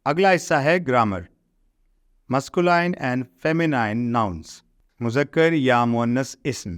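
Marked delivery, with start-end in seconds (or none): 2.3-4.4 slow, careful reading of the English rule; slow textbook reading. Shown as a demonstration. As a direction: read the rule out word by word for the class. Reads heading slowly. (انگریزی قاعدہ آہستہ آہستہ پڑھ کر سنانا)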